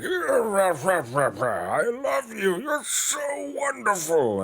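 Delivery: very high, slow, swooning sweetness; gushing fake ally. Britain courting Spain in a swooning falsetto. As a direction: gush like an insincere suitor. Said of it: "they were like".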